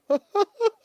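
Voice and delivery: high, loud fake giggle; mock cackle. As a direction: cackle mockingly. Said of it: "That's what she does".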